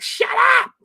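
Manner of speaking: high, loud yell; exasperated shout. Retold or imitated. Viewer yelling at Lorraine to let the guest talk. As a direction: shriek it at the TV. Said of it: "I'm just like".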